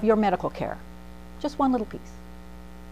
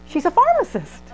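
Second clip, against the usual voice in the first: proud boast; 10 semitones higher, 4 dB louder than usual. Retold or imitated. Mother-in-law's proud claim.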